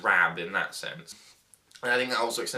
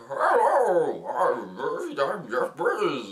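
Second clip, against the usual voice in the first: lightly slurred drunk Bane impression; a hint of a drunk Bane; 5 semitones higher, 5 dB louder, much slower than usual. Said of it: "sounds a bit like a drunk Bane".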